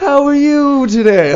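high, loud mock-cheery greeting; hammed-up customer-service voice; over-friendly server. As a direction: gush it like a fake-friendly host. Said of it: "is this really who you".

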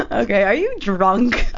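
normal talking voice

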